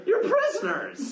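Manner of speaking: high, stretched incredulous retort; exasperated exclamation. Real, not acted. Player telling the prisoners the obvious, exasperated. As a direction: exclaim it slowly in disbelief.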